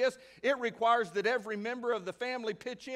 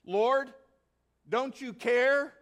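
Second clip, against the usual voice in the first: a plaintive, drawn-out plea, higher and louder; Martha's complaint; 4 semitones higher, 5 dB louder, slower than usual. Acted. Martha complaining to Jesus, a slow plaintive whine. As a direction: whine it at Jesus like an overworked hostess. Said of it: "She came to Jesus and asked".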